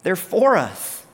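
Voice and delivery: high, incredulous scolding; exasperated Jesus. Acted. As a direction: push back in disbelief at their pettiness.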